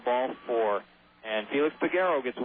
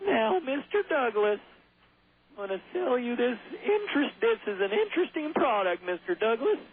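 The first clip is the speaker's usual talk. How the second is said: high-pitched TV salesman pitch; high, drawling character impression; Mister Haney; 10 semitones higher than usual. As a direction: do the salesman from an old sitcom, voice way up. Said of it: "with, uh, Mister Haney".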